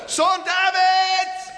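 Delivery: high, slow, loud desperate cries. Acted. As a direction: shout over the whole crowd. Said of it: "schrie nur umso lauter".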